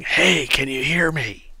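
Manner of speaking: slow, soft and distant, as if through stone; muffled from above. Acted. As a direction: call faintly from far overhead. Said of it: "you hear a muffled voice from above".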